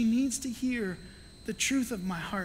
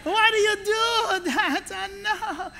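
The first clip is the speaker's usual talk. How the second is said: much higher, slow mock parent; imitated exasperated parent; 10 semitones higher, 3 dB louder, slower than usual. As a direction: drag it out like a fed-up parent. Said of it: "I get".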